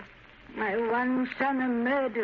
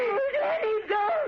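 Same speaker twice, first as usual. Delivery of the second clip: terrified scream; 13 semitones higher than usual. Acted. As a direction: scream in terror for her son. Mother screams at grenade.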